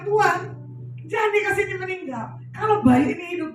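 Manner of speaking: high, loud appeal to God; pleading prayer. Retold or imitated. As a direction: plead urgently for the baby's life. Acted urgent prayer for dying baby. (doa memohon dengan suara tinggi dan lantang)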